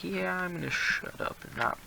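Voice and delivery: a slow, meek, shy mutter; lightly voiced timid self at school; shy classmate. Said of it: "I'm one of those people that's just like".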